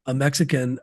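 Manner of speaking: usual speaking voice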